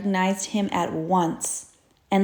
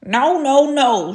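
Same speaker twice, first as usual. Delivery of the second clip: fussy duck refusal; 5 semitones higher, 7 dB louder than usual. Acted. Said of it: "she said".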